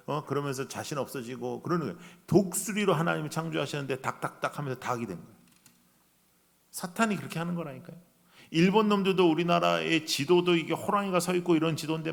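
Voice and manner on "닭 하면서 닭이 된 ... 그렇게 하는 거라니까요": ordinary delivery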